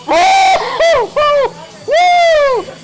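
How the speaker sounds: very high, loud whooping; cheering whoops. Real, not acted. Commentator cheering the game-saving kill, high loud whoops. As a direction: whoop like a fan at full volume.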